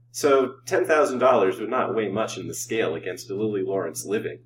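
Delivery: plain reading voice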